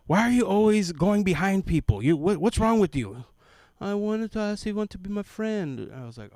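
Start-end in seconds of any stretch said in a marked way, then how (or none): 0.1-3.3 loud, high scolding mother; scolding mom. Retold or imitated. Mother scolding her boy in the shoe store, loud and high. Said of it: "his mom goes". 3.8-5.9 put-on high small boy voice; little boy. Little boy telling his mom he wanted a friend, high.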